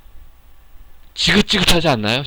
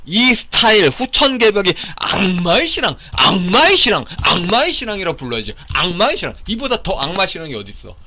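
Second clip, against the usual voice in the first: heated denunciation; 6 semitones higher than usual. Real, not acted. Denouncing a rival faith as demonic.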